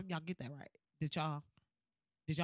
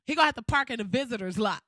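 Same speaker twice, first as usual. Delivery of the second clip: the payoff, said louder and faster; 5 dB louder, faster than usual. Pretend. To the audience, loud petty payoff about Obama's guest. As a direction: punch it out loud and quick. Same words repeated out loud.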